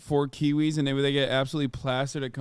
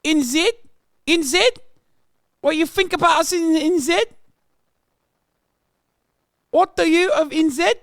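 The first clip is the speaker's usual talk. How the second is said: hammed-up New Zealand accent, much higher and louder; shrill Kiwi caricature; 14 semitones higher, 8 dB louder than usual. Streamer yelling at a Kiwi chatter in a mock accent. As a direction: scream it like a mad Kiwi bloke.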